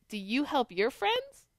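usual speaking voice

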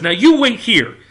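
loud, high commanding order; a president shouting down a rival; retold yell. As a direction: snap an order at a bully. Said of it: "he starts yelling at Stalin".

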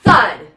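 "Thud" dropped short, loud and low; "Thud" said like a cup hitting the floor. Shown as a demonstration. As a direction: land "Thud" with a heavy thump. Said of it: "we put it down and we say".

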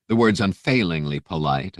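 ordinary delivery

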